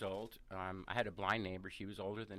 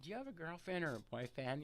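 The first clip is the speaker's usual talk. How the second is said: higher, lightly voiced small boy; nervous little kid; 6 semitones higher than usual. Boy nervously asking his blind neighbor, lightly imitated. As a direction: imitate a kid plucking up courage. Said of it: "your voice cracks and you get".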